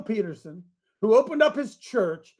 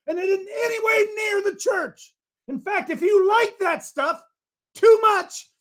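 fired-up preaching; 13 semitones higher than usual. Real, not acted. Pastor firing up his men, shouting. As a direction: preach it hard, caught up in the fire.

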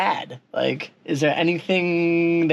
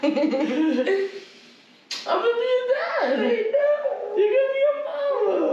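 much higher, delighted exclaiming; excited. Real, not acted. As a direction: shout the news with joy. Announcing they'll be parents.

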